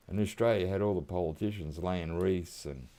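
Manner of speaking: ordinary delivery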